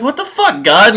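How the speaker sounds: aggrieved cry at God; indignant outrage. Retold or imitated. Cain's sacrifice rejected.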